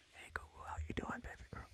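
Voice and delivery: soft seductive whisper; right in your ear. Pretend. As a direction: whisper it close and intimate. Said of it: "You gotta be super".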